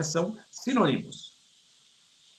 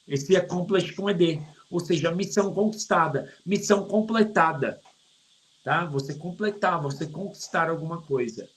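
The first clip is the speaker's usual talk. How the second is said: ordinary talk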